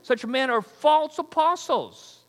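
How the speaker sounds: hint of a posh British orator; mock British accent. Pastor teasing slick false apostles in a mild British accent. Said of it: "Maybe even a British accent".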